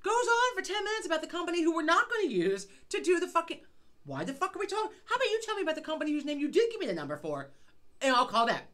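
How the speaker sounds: ranting, fast at the end; exasperated rant. Real, not acted. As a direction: rant about him wasting time. Ranting about the talkative repairman.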